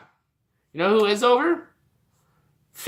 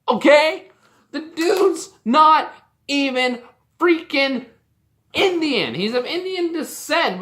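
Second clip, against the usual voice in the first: higher, emphatic indignation; heated rant; 5 semitones higher, 3 dB louder than usual. Real, not acted. Wrestling fan objecting that he's not from India, heated. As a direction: argue it hotly, hammering the words. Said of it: "I'm sorry I'm getting so heated".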